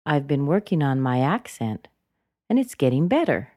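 "accent" nudged up before a pause; small lift on "accent", big final fall on "better"; rise and fall intonation. Shown as a demonstration. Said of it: "So one more time".

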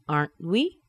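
"Aren't we" with held T, rising end; rising intonation. Shown as a demonstration. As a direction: let "we" swing up as a question. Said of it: "That T is held inside of the mouth".

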